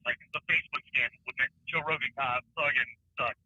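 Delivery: faint caller over a bad line; distant, broken phone audio; phone speaker. Real, not acted. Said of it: "I can barely hear you".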